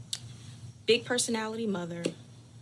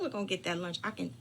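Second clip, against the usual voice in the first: ordinary delivery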